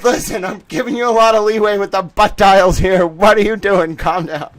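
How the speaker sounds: imitating stern judge. Judge scolding the lawyer, mock stern. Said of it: "She should have said".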